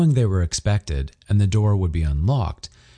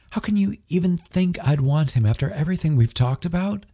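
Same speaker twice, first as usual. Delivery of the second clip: higher, lightly voiced hurt protest; Ryder hurt; 10 semitones higher than usual. A narrator's reading. Ryder protesting to his jealous partner, hurt and higher. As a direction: plead to be believed.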